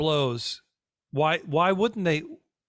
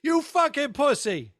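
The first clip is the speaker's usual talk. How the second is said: heckling shout; 11 semitones higher, slower than usual. Real, not acted. Co-host heckles comedian answering cabin phone, high taunting shout. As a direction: yell in protest, dragging out the final insult.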